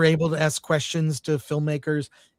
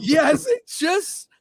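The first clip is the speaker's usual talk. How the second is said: laughing; 9 semitones higher, 3 dB louder, much slower than usual. Real, not acted. Laughing along with the quote.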